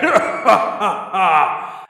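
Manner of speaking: high, loud villainous cackle; evil laugh. Acted. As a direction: cackle like a gleeful demon. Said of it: "malevolent, evil voice".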